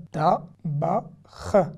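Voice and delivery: normal talking voice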